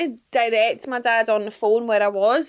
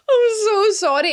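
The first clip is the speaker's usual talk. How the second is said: high, loud, tearful sorry; retold sobbing apology; 10 semitones higher, 4 dB louder than usual. Apologising to the van driver, voice breaking into tears.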